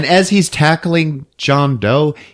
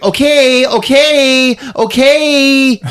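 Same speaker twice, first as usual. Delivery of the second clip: higher, louder flustered okays; higher repeated okay, cutting in; mock host; 13 semitones higher, 4 dB louder, much slower than usual. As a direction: hurry her off like a harried host. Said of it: "She's like".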